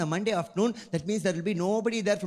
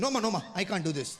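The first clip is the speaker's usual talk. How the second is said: rattled off in a hurry, a hint of an Indian uncle; fast nervous refusal; faster than usual. Indian man turning away a stripper, fast nervous refusal. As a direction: brush her off quickly and nervously. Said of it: "Indian man refusing".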